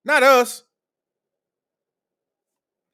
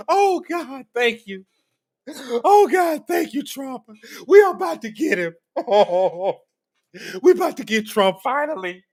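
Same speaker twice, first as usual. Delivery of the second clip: overjoyed crying-out parody of a gleeful critic; hammed-up ecstatic Aaron; 5 semitones higher, slower than usual. Mocking his commenter as ecstatic over Trump, hammed-up shriek of joy. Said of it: "That's what he really think, y'all".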